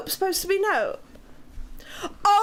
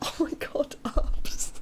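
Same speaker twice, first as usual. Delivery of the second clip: ordinary talk, nothing marked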